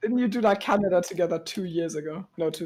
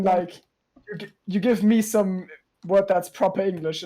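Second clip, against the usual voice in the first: normal talking voice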